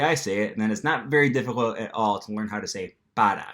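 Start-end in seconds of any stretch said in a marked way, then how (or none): 3.1-3.5 "para" with a tapped R, not American; Spanish "para" with a quick tap for R; Spanish pronunciation. Shown as a demonstration. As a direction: flap the R in "para". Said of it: "not to pronounce that R in the American".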